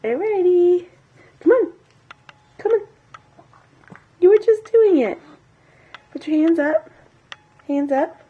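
very high, loud coaxing. Real, not acted. Mother coaxing baby again.

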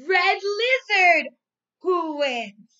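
put-on loud, lively storybook reading for young kids; reading to children. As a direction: read it out big and bright, like to a class of little ones. Teacher reads storybook to class.

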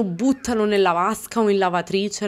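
ordinary talk, nothing marked

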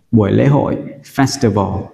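normal talking voice

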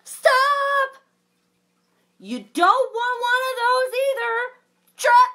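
loud, high alarmed yell; shouting stop. Acted. Storyteller warning the reader off a dragon, loud shout. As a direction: stop the child before it's too late.

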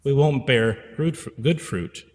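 ordinary talk

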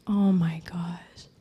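hushed whisper of sudden realisation; awed whisper. Retold or imitated. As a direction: breathe it out in wonder. Revelation dawns on her.

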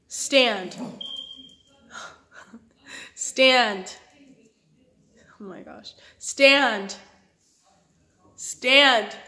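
usual speaking voice

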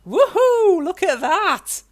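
high, loud whoop of delight. Real, not acted. Gamer throwing his new trident, loud delighted whoop.

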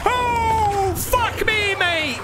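high, shouted panic; panicking gamer. Real, not acted. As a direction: yell in sheer fright. Caught by the monster.

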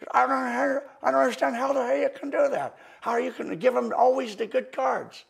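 put-on loud, high, astonished old professor shouting; Vernon voice. Professor Vernon after the show demanding what Richard did, loud high astonished shout. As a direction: blurt it out like the old master who can't believe his eyes. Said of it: "After the show, he goes".

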